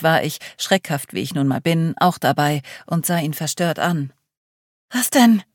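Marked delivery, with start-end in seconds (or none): 4.9-5.4 high, louder nervous call; startled and jumpy, blurt it out; nervous cry. Acted. Charlie startled by Paul's warning, nervous high cry. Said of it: "rief Charlie nervös".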